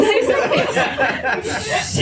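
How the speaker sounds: usual speaking voice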